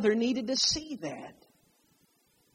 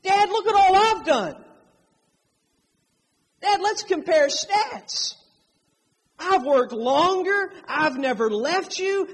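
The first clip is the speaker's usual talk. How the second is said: higher, louder, aggrieved complaint; whining older brother; 6 semitones higher, 6 dB louder than usual. Retold or imitated. Preacher voicing the resentful brother to his father, loud whine. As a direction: plead your case to dad, jealous and loud. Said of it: "older brother".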